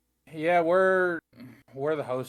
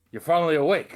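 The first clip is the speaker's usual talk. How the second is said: raised, louder wake-up greeting; Skyrim intro line; 3 semitones higher, 6 dB louder than usual. Retold or imitated. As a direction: greet a just-woken prisoner. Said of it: "That's the intro".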